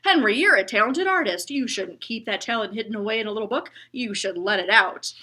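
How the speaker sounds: loud, hearty encouragement; booming bus driver. Acted. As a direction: boom warmly at the boy. Bus driver praises Henry.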